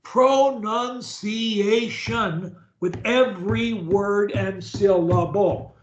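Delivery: slow, every syllable sounded out separately; over-enunciated. Shown as a demonstration. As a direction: stretch each syllable of PRONUNCIATION, EVERY, WORD and SYLLABLE. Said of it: "unless you think".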